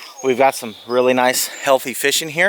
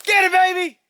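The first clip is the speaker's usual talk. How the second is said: high, excited call to a fisher; excited cheer. Real, not acted. Cheering a catch from shore.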